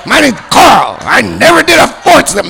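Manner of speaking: high, loud, spitting-mad impression; Carl. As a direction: sputter it in mock fury. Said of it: "he's, like, spitting".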